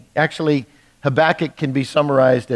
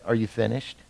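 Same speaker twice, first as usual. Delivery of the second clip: hushed and tender, much softer and lower; a quiet, tender question with no rebuke; very gentle; 5 semitones lower, 10 dB quieter than usual. Retold or imitated. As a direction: ask it gently, like a patient father. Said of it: "the Lord just very gently said".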